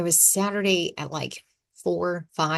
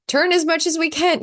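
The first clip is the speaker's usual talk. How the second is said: higher and louder playful urgency; mock urgent call; 11 semitones higher, 5 dB louder than usual. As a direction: steer away from the iceberg with a laugh. Imagined inner voice steering.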